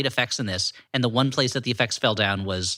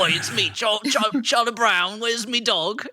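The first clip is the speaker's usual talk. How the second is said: thick mock Cockney, pitched high; hammed-up Cockney cartoon child; high, stumbling comic Cockney voice; Charlie Brown; 7 semitones higher, slower than usual. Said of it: "have thick Cockney accents".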